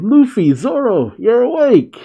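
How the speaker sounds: high-pitched joyful calling out; excited cry. Retold or imitated. Acted happy cry at friends waking.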